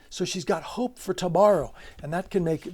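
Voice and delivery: ordinary delivery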